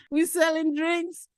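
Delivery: high excited retelling; gleeful. Real, not acted. Gleeful memory of selling drinks.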